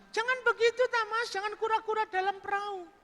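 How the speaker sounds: very high falsetto coaxing; coaxing woman. Acted. Woman coaxing Burhan to remember her, high falsetto. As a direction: coax him in high falsetto.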